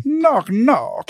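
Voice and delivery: high Southern cartoon lawyer impression; hammed-up cartoon Southern accent; chicken lawyer from Family Guy. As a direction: do a silly high-pitched cartoon voice. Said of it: "like the chicken lawyer from, uh, Family Guy".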